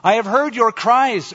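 high, loud proclamation; announcing. A narrator's reading. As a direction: announce it boldly to a whole crowd. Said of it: "it announced".

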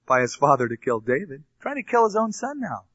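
ordinary delivery

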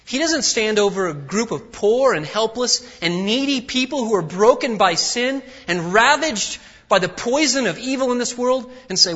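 loud, high, driving sermon voice; full-force preaching. Acted. Preacher to church, loud and driving. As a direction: preach it out to the congregation.

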